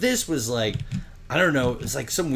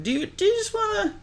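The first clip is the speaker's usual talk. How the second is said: silly high voice. Retold or imitated. Proposing skipping work, silly voice.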